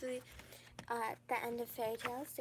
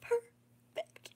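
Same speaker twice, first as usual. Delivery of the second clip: excited squeal; 11 semitones higher, 5 dB louder than usual. Retold or imitated. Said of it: "and I was like".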